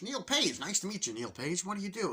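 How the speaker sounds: high, eager salesman voice; chatty salesman. Retold or imitated. Salesman greets seatmate eagerly, high chirpy voice. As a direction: pump up the overfriendly pitch.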